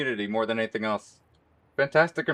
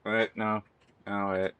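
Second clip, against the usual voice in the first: ordinary talk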